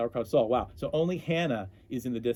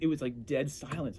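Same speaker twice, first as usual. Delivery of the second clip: ordinary delivery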